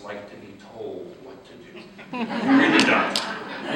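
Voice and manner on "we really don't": slow, higher, with a laugh on "really"; laughing admission. Real, not acted. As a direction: chuckle it out like a shared confession. Joking that nobody likes orders.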